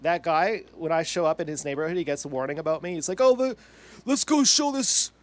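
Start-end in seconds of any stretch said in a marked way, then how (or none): 3.2-5.1 high, loud, slow taunting imitation; mock a man rallying others, drawn out; exaggerated pitched-up swagger, loud; mocking impression. Mocking the guy's imagined reaction to him.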